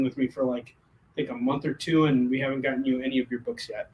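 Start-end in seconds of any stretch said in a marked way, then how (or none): none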